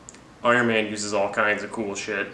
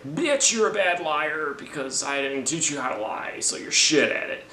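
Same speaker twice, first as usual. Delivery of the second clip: higher, scornful put-down; mock Thanos scolding; 5 semitones higher than usual. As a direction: sneer at her lying like a hammed-up villain. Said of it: "Thanos is like".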